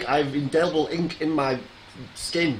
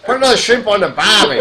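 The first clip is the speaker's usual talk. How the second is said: a fake Australian accent, shouted high through laughter; hammed-up Australian accent; 6 semitones higher, 9 dB louder than usual. Retrying the Australian line, a bad loud Australian accent. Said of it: "I can't even do an Australian accent".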